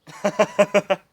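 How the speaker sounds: high, loud cackling laugh; real laughter. Real, not acted. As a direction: crack up at your own slip. Laughs at own muddled instruction.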